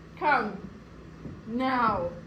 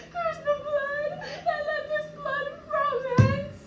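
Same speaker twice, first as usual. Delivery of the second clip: high, anguished sobbing cry; wailing curse; 11 semitones higher, 4 dB louder than usual. Acted. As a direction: howl the curse through tears. Widow curses the killer, weeping.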